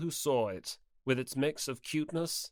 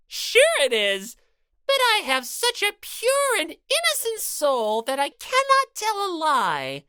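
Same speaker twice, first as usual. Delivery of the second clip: lightly voiced bossy bear mascot; Monokuma voice; 7 dB louder than usual. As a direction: lecture her with smug cruelty. Monokuma smug.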